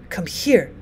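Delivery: soft, confiding near-whisper; old woman softly drawing someone in. A narrator's reading. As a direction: drop to a hushed, secretive murmur. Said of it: "she whispered".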